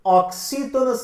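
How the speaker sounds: ordinary talk, nothing marked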